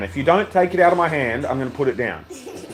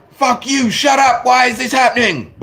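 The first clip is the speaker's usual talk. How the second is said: high, hammed-up outburst of a small child; mock toddler tantrum; 9 semitones higher than usual. Parent imitating their eldest son, mock tantrum. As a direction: imitate an outraged three-year-old, breaking into a laugh. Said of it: "he's like".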